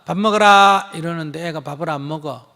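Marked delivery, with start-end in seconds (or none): 0.0-0.9 loud call to come eat; a loud, plain announcement that dinner is ready. Shown as a demonstration. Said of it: "이렇게 알리고".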